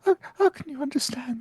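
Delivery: panicked disbelief. Acted.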